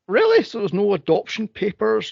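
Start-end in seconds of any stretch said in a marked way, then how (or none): 0.1-0.4 squeaky high disbelieving really; high loud incredulous really. Retold or imitated. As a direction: shoot way up in mock disbelief. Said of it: "I'm like".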